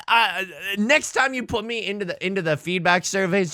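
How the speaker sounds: usual speaking voice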